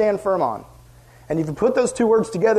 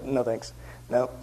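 curt refusal; 7 semitones lower, 9 dB quieter, slower than usual. Retold or imitated. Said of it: "all your leaders are like".

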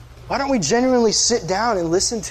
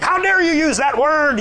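pitched-up mock preacher scolding; a pastor's hammed-up scandalized scold; mock outrage; 8 semitones higher, 3 dB louder than usual. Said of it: "preaching to this guy and be like".

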